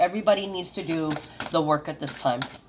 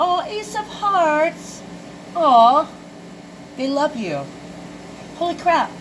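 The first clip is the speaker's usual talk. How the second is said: touched delight; 8 semitones higher, slower than usual. Real, not acted. Reader touched by a loving card, delighted aw. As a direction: melt at the good card.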